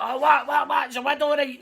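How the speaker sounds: high, loud, calling down from a treetop; shouting down from a tree. Retold or imitated. Bones yells down from the top of his tree, loud. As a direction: yell it like he's half deaf up high.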